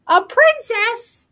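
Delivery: high, slow, astonished question; a slow gasp of wonder; surprised cry. A narrator's reading. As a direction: jump at the news of a princess. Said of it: "Shrek cried".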